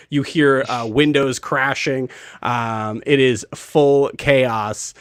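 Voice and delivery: usual speaking voice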